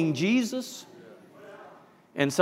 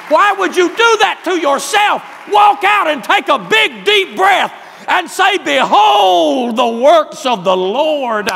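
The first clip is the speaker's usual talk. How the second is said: loud, high, slow and ringing; full-force preaching; 12 semitones higher, 12 dB louder than usual. Acted. Preacher booming to his church about God's handiwork, loud and high. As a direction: thunder it out to the whole congregation.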